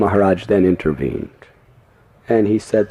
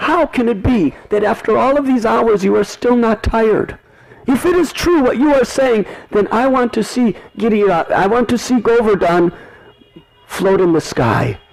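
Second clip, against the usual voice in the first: high, insistent mother's challenge; doubting mother; 13 semitones higher than usual. Acted. Yashoda challenges Krishna, high insistent voice. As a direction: demand proof, high and urgent. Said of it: "And Mother Yashoda said".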